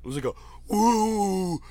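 a high, limp imitation of "woo", no power behind it; mock feeble woo. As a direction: mimic a pathetic half-hearted "woo". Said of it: "the absolute worst woo".

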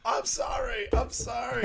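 very high imitated panic cry; panicked screaming. Apologising while about to stab the pig, panicked screaming. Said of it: "It's like a big panic, just, like, screaming".

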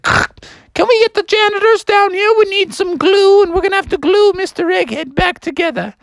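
high falsetto announcement; Mother Goose. Acted. Goose calls janitors on intercom, high falsetto announcing. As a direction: call out high like over an intercom. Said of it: "the goose got on the school intercom phone".

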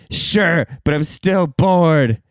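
put-on slow, dragged-out kid whine; whiny kid. Kid accepting the sandwich, slow bored whine. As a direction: drawl it like a bored child.

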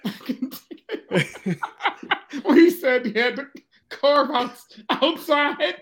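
breathless wheezing laugh; laughing too hard to talk; helpless laughter. Real, not acted. Can't stop laughing at cohost.

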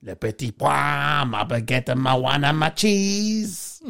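slow put-on French accent; mock French. Mate mocking French food in a put-on French accent.

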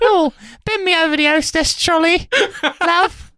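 put-on high falsetto Norfolk accent. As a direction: camp up a rural Norfolk lass. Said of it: "I don't know if that's a Norfolk accent".

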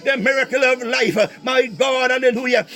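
normal talking voice

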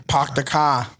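Boston accent, R's dropped; put-on Boston "pahk the cah"; mock Boston accent. Shown as a demonstration. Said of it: "We sound like that?".

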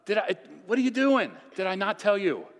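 high, loud bro, easing down; acted Jesus ribbing Peter; playful exasperation. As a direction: tease a friend who lost his nerve. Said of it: "He's like".

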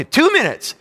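high-pitched, excited announcement; frantic timing call. Retold or imitated. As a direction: call out the count in a jumpy, high voice. Acting himself timing wife's contractions.

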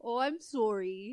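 Canadian sorry. Acted. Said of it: "you say it so weird".

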